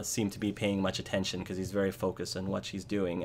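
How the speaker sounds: ordinary talk, nothing marked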